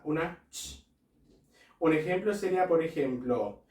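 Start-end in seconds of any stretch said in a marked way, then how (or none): 0.0-0.9 "una ch" with a soft Spanish ch, quieter; ch sound demo. Shown as a demonstration. Teacher showing German CH like Spanish ch. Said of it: "como una C H española".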